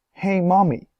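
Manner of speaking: Veruca calls out high and loud; a spoilt girl yelling for her mother; shouting. A narrator's reading. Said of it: "shouted Veruca Salt suddenly".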